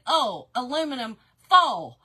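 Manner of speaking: "oh" and "foal" loud, for oil and foil; Southern oil vowel. Shown as a demonstration. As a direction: insist loudly on "oh" and "foal" without the "oy". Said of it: "I said".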